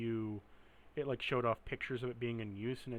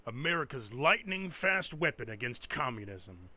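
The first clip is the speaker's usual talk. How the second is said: propaganda announcer; 3 dB louder than usual. Acted. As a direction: boom it out like a wartime newsreel. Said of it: "in the propaganda terms".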